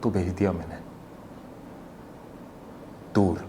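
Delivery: low, very soft admission; hushed confession. Acted. Son confessing he sent his mother away, hushed. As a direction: drop it right down, low and quiet. Killer's understated confession of the murder.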